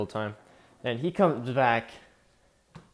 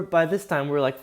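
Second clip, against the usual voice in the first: normal talking voice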